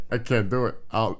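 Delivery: ordinary talk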